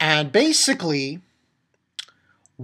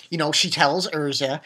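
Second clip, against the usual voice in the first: usual speaking voice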